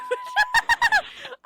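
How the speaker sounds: high burst of laughter; loud laugh. Real, not acted. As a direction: cackle in disbelief. Laughing at brawl footage.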